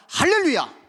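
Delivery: loud, high triumphant cry; shouted hallelujah; jubilant hallelujah. Acted. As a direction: throw it up to the rafters. Preacher exclaims hallelujah.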